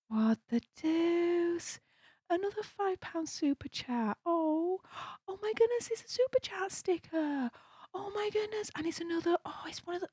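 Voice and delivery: high, gasping astonishment; delighted surprise. Real, not acted. Streamer spots another super chat, delightedly surprised. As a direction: react amazed, pitch shooting up.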